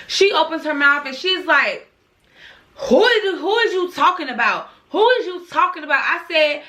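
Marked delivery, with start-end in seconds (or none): 2.8-6.0 mocking retort.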